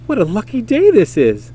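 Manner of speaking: put-on bright, lifted voice of a thrilled young donkey; lightly voiced gleeful boy; delighted. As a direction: read it like he just struck luck. Delighted boy after wishing rain.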